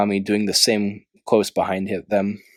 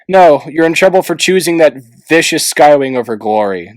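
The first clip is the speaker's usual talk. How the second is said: louder sharp retort; snapping back; 5 semitones higher, 6 dB louder than usual. A narrator's reading. Tsunami snapping back at Clay, sharp retort. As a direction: snap it back at him. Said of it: "snapped back".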